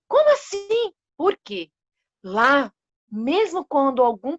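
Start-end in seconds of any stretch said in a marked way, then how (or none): 0.1-1.7 the animals, frightened, asking why; alarmed disbelief. Acted. Panicked animals protesting the decree, shocked and high. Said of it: "A bicharada ficou em pânico".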